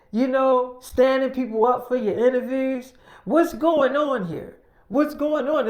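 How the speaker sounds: loud, high-pitched acted scolding; angry confrontation. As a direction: play an exasperated team demanding answers. Team confronts her in acted quarrel.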